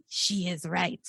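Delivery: hushed, lightly put-on villain accent, vaguely French; a touch of a cartoon bad guy accent; mock bad guy accent. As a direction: imitate the henchmen's cheesy foreign accent, low-key. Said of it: "Their accents are hilarious".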